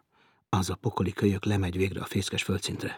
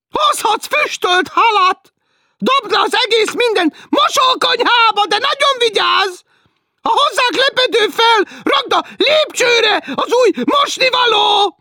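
much higher, loud and slow nagging; shrill teasing woman; 12 dB louder, slower than usual. Acted. Woman below teasing Martin, shrill and slow. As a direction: drag it out like a shrill landlady.

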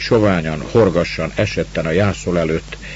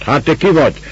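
higher, sharper demand; grumbling demand; 8 semitones higher, 5 dB louder than usual. A narrator's reading. Master confronts the stranger in the stable, grumbling. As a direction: challenge the stranger, annoyed. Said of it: "mordult rá".

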